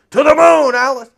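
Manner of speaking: hammed-up sitcom threat; Ralph Kramden impression. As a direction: bellow it like a sitcom husband. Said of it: "Remember the, the honeymooners?".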